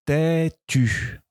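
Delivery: têtu drawn out slowly; slow dictation. Shown as a demonstration. Teacher dictating, slow têtu. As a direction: linger on the U of têtu. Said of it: "Vous entendez U à la fin".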